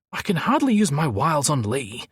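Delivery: high-pitched huff. A narrator's reading. Gazza balks at charming Lee, huffing. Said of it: "Gazza huffed".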